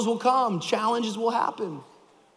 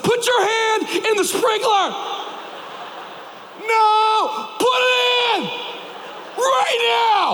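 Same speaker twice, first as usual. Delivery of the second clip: commanding shout; 13 semitones higher, slower than usual. Retold or imitated. Dad ordering his crying son to stand up, shouting. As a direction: snap him out of it with a shout. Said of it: "I said".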